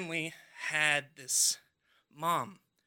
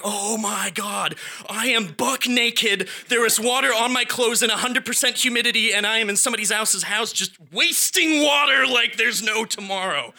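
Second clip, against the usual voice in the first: panicked rant; 5 semitones higher, 7 dB louder than usual. Retold or imitated. Panicking alone, flooding bathroom.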